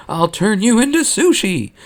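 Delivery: high mock battle cry; hammed-up threat screamed high. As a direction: Mon charging Akbar with a high mock scream. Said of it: "she screams".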